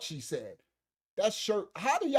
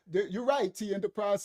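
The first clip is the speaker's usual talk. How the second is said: ordinary talk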